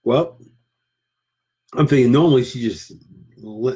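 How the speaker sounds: ordinary delivery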